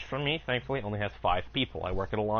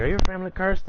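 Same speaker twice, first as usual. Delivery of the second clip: put-on Puerto Rican accent; 9 semitones higher, 8 dB louder, faster than usual. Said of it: "she's like".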